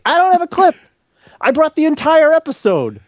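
high-pitched imitation of a teasing host; mock showman reveal. Panel host teases the chanting crowd, in high mock protest.

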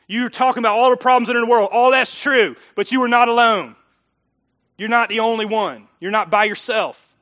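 loud, pressing preaching; loud sermon climax; preaching at full force. Real, not acted. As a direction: build to a forceful reassurance.

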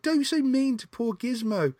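high-pitched protective protest; mock pleading. Pleading on behalf of bullied Gizmo.

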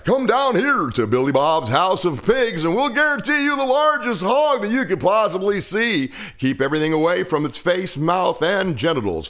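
booming high pitched hillbilly pitchman voice; loud over-the-top pig farm commercial; radio ad announcer. Pretend. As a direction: hawk it like a loud local radio ad. Mock hog-farm radio ad.

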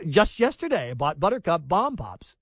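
normal talking voice

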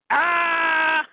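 high, sustained mock scream; fight yell. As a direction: hold an acted battle cry. Guard yells during acted fight.

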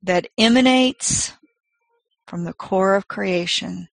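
slow, soft meditation voice; guided meditation. Acted. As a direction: gently guide them inward, unhurried.

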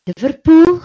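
high, loud stab at a Liverpool accent; Liverpool accent attempt. Shown as a demonstration. Asked to do Liverpool, a botched Scouse attempt. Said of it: "Oh, go, go Liverpool".

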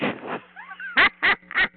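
sudden very high shriek of a laugh. Real, not acted. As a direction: let a mocking laugh fly up high. Mocking the caller on chatline.

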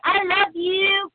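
high, very drawn-out sweet-talk; butter up a parent, stretching every word; wheedling child. Acted. Child angling for something from parents, wheedling. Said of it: "I can hear it in that voice".

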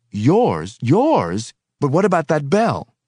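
he can't believe it, voice climbing on the last question; outraged disbelief. A narrator's reading. Narrator stunned by her bedroom, rising incredulous voice.

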